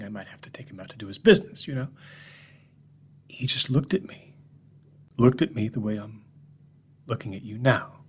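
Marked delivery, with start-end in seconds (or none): none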